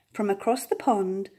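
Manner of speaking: plain reading voice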